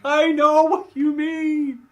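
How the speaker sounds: high, loud and slow, choked with emotion; a pastor moved to tears by a stranger's dog story; tearing up. Retold or imitated. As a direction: let the voice crack as the tears come. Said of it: "And we're both tearing up".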